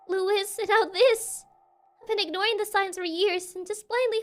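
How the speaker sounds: a hint of a worried young client confiding; lightly voiced troubled client.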